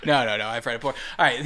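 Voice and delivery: ordinary delivery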